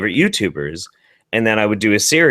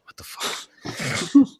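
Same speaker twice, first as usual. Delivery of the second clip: shocked whisper; 4 dB quieter, much slower than usual. Real, not acted. Reaction whisper to story.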